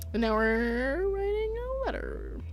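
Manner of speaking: slow, stretched-out words with rising pitch; drawn-out delivery. Pretend. Reader veers off into writing a letter, drawn out. Drawn-out mock announcement between asides.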